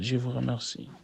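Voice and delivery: usual speaking voice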